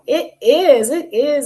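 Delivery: loud, tearful burst of joy; near-crying loud delight; overjoyed. Retold or imitated. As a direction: blurt it out, overcome with happiness. Doctor overjoyed, everyone in tears.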